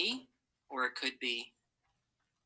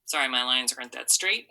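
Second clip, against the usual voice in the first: normal talking voice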